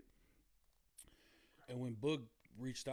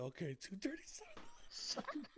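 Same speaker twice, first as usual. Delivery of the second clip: whisper; 5 semitones higher, 8 dB quieter, slower than usual. Retold or imitated. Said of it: "I said".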